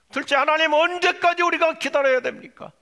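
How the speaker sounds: loud, high plea to God; impatient outcry. Acted. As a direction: cry out as if waiting has become unbearable. Second complaint acted out to God. (높고 큰 소리로 호소하듯)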